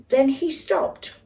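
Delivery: usual speaking voice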